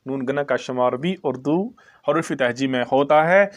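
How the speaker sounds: usual speaking voice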